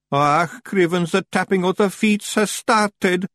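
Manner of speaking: high, slow Scots moan; hammed-up high lament; moaning dread. A narrator's reading. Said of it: "moaned Daft Woolly".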